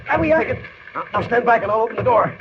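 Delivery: high, loud frantic voice; frantic, racing against the fire; panicked. Acted. Trapped by fire.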